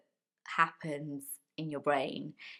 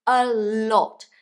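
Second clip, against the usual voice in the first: a lot said much louder and higher; stressed a lot; 5 semitones higher, 11 dB louder than usual. Shown as a demonstration. Teacher insisting on how much English learners need. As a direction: punch a lot hard and high. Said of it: "When I say a lot, I mean".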